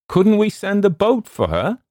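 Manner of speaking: high, loud question; calling out. A narrator's reading. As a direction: call the offer over to the boat. Sailor calls question across water.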